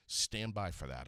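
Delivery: ordinary delivery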